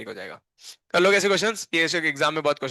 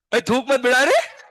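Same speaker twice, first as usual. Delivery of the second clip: startled protest; 9 semitones higher, 4 dB louder than usual. Real, not acted. Student sprays spit while talking.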